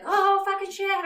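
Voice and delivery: slow, high mock outburst; exasperated curse. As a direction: act out swearing that slips out. Retelling a slipped curse.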